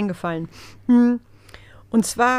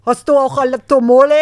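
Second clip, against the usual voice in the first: put-on loud excited kid voice; kid asking the DJ; 4 semitones higher, 11 dB louder than usual. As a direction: call out like an eager kid. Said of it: "zu unserem D J und sagt".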